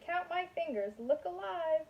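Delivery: lightly voiced cartoon figure calling out; Matman's voice.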